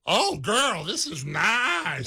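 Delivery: put-on high, slow, sassy "oh girl" voice, drawn out; sassy Instagram voice. Cat dad voicing Fat Maui like an Instagram diva, slow and sassy. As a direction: purr it like a diva admiring a new place. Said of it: "you've heard that Instagram".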